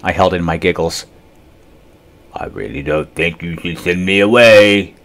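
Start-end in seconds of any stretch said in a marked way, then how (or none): 2.3-4.9 warning. Acted. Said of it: "he warned".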